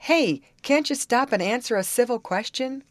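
high, mildly angry call. A narrator's reading. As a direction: cry out in irritation. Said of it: "his voice was angry".

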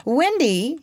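high, coaxing call of her name; sly boy buttering her up; cunning. A narrator's reading. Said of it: "He had become frightfully cunning".

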